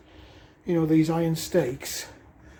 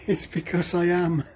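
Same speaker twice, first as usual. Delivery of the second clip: laughing; 4 dB quieter than usual. Real, not acted. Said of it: "If I sound like I'm out of breath".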